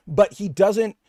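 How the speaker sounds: ordinary delivery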